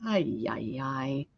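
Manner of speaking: low, soft ay ay of dismay; low dismayed groan. Real, not acted. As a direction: groan at the mess. Groaning at gesso mess.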